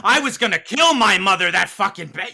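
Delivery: loud high furious ranting outburst; mock rage. Arguing about Joker, he acts out losing his temper, shouting. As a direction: explode with fury, shouting.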